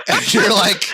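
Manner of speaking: laughing hard mid-sentence. Real, not acted. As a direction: giggle through the words.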